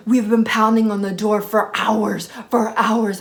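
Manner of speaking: loud, in-your-face mock rant; retold screaming. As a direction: imitate a guy yelling accusations right in someone's face. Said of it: "they're still screaming at me".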